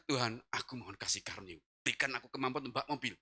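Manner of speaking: low, soft, weak plea; pleading prayer. Retold or imitated. Sick man asks God for strength, low pleading. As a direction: pray with very little voice. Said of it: "minta kasih".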